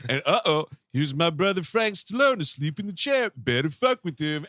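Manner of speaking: slurred tough-guy impression; loose, mumbly celebrity video voice; Sly. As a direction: imitate a laid-back action star filming himself. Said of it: "I guess he was like".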